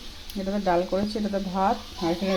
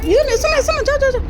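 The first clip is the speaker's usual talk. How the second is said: much higher, louder shooing; shooing away; 6 dB louder, faster than usual. Real, not acted. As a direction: chase them off. Shooing pets, calling out.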